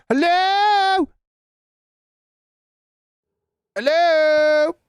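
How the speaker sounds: high, loud drawn-out hello; calling out. Pretend. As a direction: call into the dark house. Calling out to the ghost.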